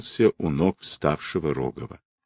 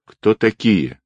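ordinary delivery, nothing marked

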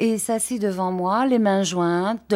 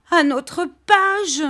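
much higher, slow sobbed answer; sobbing; 12 semitones higher, 5 dB louder, slower than usual. Acted. Dora naming the page to her husband, sobbing and slow. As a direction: choke it out through tears. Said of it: "dit-elle en sanglotant".

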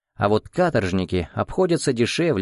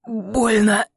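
hissed; 11 semitones higher than usual. Acted. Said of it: "прошипел".

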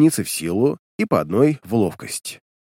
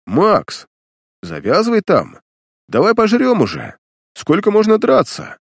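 orc voice; 9 semitones higher than usual. Acted. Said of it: "голос орка".

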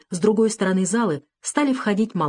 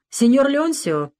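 slow, polite, raised greeting; courteous bow; 8 semitones higher, 4 dB louder, slower than usual. A narrator's reading. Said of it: "учтиво поклонился".

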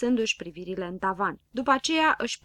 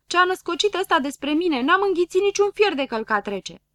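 high, loud indignant complaint; yelled protest; 8 semitones higher, 7 dB louder than usual. A narrator's reading. Friend denying he swallowed an iron, indignantly yelling. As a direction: protest loudly, pitched up. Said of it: "țipă Grăbilă".

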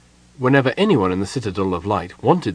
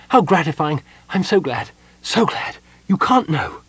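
higher, overcome gnome voice; sobbing with joy; 7 semitones higher than usual. A narrator's reading. Gnome flinging his arms round the kender, sobbing. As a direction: gush as if tearfully grateful.

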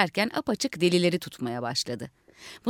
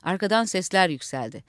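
ordinary delivery

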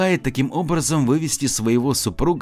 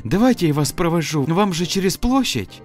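put-on high, bright eager girl; sweet young woman, eager to help; 8 semitones higher than usual. Snegurochka thanks and offers escort.

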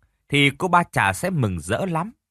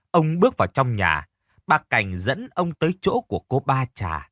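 ordinary delivery, nothing marked

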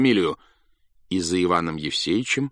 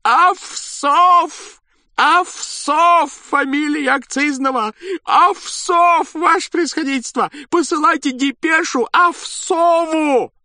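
much higher, slow, loud cry of joy; joyful shout; 7 dB louder, slower than usual. A narrator's reading. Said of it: "не своим голосом".

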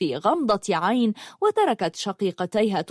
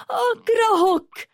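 much higher, slow, louder shriek; hateful scream; 3 dB louder, slower than usual. Acted. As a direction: drag out the scream of hate. Sister's hateful outburst.

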